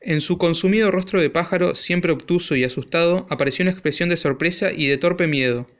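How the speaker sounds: ordinary delivery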